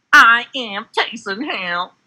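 slow, high monotone robot voice. Pretend. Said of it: "Robot voice".

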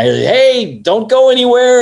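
high, loud retold holler; calling out. Makeup crew catches him sneaking past, high loud call. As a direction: call after someone to stop them.

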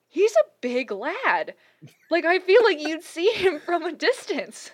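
slow, loud, stressing the size; loud and emphatic. Real, not acted. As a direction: lean in and hammer it home. Emphatic about the cryptid's size.

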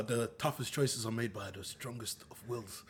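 low, slow and very quiet; soft, deep movie-villain delivery; solemn film-villain quote. Acted. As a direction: intone it gravely, slow and hushed. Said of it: "There's a whole thing here where it's like".

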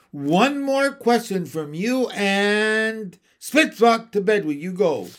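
a stern nanny drawing the line; stern threat. A narrator's reading.

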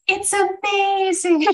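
high, slow, breathy-free awed squeak; awed girl voice. Retold or imitated. Second girl marvelling at Tamaki, high and slow. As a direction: marvel at it slowly. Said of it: "the other one goes".

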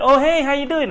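fake friendly hello. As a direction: greet the angry driver like an old friend. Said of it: "I act like".

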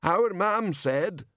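put-on slow, high, childlike big man; simple giant speaking slowly; Banjo voice. A narrator's reading.

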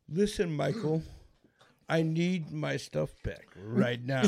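hushed, deliberate demand, slowing right down at the end. Acted.

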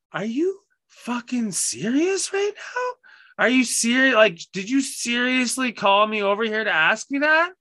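very high, drawn-out mock outrage; exasperated disbelief. Teller imitates coworker called over for nothing, exasperated. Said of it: "Like, just so frustrated".

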